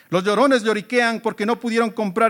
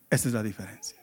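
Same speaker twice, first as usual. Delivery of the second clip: hushed emphasis; 10 semitones lower, 9 dB quieter, faster than usual. Real, not acted. Preacher summing up his point.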